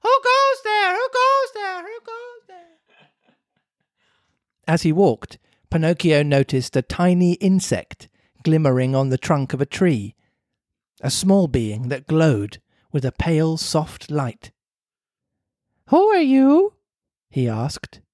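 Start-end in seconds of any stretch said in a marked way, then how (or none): 0.0-3.3 very high echo fading away; distant echo dying out. Acted. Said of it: "the faraway hills echoed back to him". 15.9-16.7 high, louder puppet voice; Pinocchio voice. Acted. Pinocchio asks the insect.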